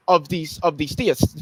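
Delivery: ordinary talk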